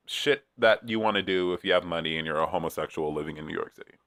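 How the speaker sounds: ordinary talk, nothing marked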